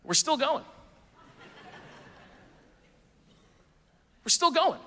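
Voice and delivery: amused wonder at a huge menu; mock amazement. Real, not acted. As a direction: still scrolling, sounding amazed. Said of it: "This is one restaurant's menu".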